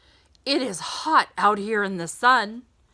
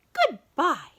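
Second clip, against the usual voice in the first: a higher, other voice for the second goodbye; high sing-song goodbye; 7 semitones higher than usual. Acted. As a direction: answer back in a lifted character voice. Dog and hat lady parting.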